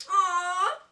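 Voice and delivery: touched to tears by a note; teary squeal. Real, not acted. Vlogger finding a friend's handwritten note, teary high squeal. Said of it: "I'm fangirling so hard".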